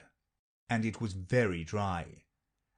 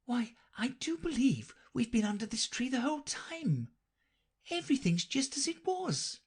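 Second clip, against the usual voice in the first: surprised Alice; 14 semitones higher than usual. A narrator's reading. Alice finds they never moved, high surprised. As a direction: look round and can't believe it. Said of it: "in great surprise".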